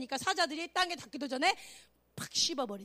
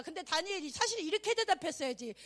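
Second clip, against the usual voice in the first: ordinary delivery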